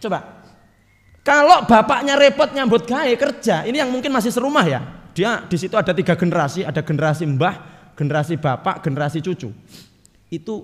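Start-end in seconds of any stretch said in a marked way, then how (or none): 1.2-4.8 raised preaching voice. Real, not acted.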